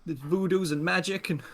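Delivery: high, stammering confusion; jittery gunman losing control; rattled young robber. Acted. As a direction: demand answers in a panicky high voice. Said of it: "and he's like".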